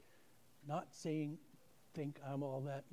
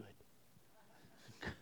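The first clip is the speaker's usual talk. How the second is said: a breathy whispered "Good." to yourself; whispered aside; 5 semitones lower, 10 dB quieter than usual. Retold or imitated. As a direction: whisper it like a secret joke. Private whispered reaction to ladies.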